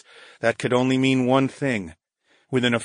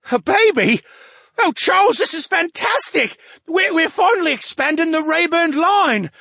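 very high, loud, gushing brain voice; thrilled about the family line; overjoyed brain; 14 semitones higher, 5 dB louder than usual. Acted.

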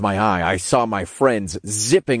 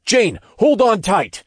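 raised, high-pitched command; urgent order; 10 semitones higher, 4 dB louder than usual. A narrator's reading. Hero ordering Jane to hold on, urgent. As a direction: call out the order sharply. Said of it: "I ordered".

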